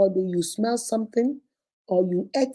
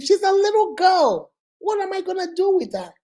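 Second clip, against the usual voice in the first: indignant protest; 9 semitones higher than usual. Retold or imitated. Man protesting he never wanted the girl, high indignant protest. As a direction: act out his outraged denial, pitched well up.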